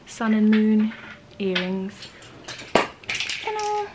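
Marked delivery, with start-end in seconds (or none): 2.7-3.9 excited. Real, not acted. Showing off her outfit.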